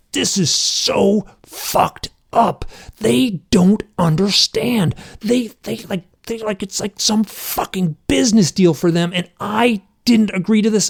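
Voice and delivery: high, strained reenacted meltdown; coming unhinged. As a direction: stretch the curses, on the verge of losing it. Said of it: "just coming unhinged".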